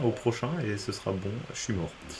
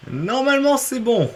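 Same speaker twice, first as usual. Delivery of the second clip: hopeful excitement; 13 semitones higher, 6 dB louder, slower than usual. Real, not acted. Hopes the level is finished.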